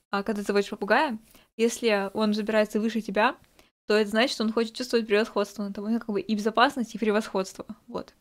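ordinary talk, nothing marked

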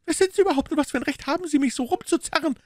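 very high, fast, aggrieved maid; indignant maid. Acted. Maid complaining to her mistress, very high and fast. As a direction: rattle off the complaint, put upon.

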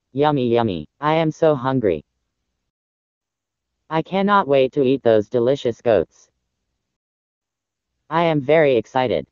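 deep, low villainous wolf voice; hungry big bad wolf. Hungry wolf talks to himself about eating goats, deep greedy voice. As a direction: drop low, a wolf relishing his next meal.

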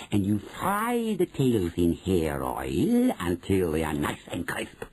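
slow, swooping witch voice. Acted. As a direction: draw out each step with relish. Witch dictates recipe.

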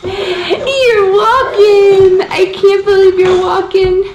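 high, slow thrilled cry; overjoyed at first steps; excited. Real, not acted.

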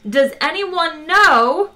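slow, high, loud yell. A narrator's reading. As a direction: stretch the question out at full volume. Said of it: "yelled Trillian".

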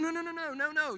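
high, loud string of no's; hasty denial. Retold or imitated. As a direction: wave off the idea urgently. Acting Nick's hasty reassurance.

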